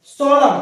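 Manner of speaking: सोलम said loud, ending on m with no n; silent n. Shown as a demonstration. Teacher announcing सोलम to students with silent n. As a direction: call out सोलम closing on the m. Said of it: "सोलम एन होता है".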